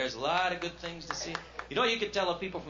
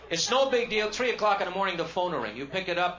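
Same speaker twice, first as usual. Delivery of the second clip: ordinary talk, nothing marked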